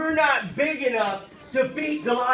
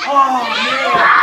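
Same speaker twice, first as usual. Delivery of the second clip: mock awe; 5 dB louder than usual. Real, not acted. Reacting to giant growl.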